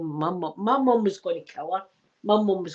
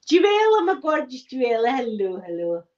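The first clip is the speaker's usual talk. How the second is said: excited hello; 4 semitones higher than usual. Real, not acted. Streamer greeting a viewer, gushing hello. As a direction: gush over a favourite fan. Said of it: "greeting a viewer".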